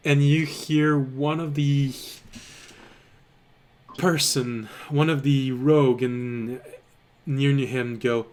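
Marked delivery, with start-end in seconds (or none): none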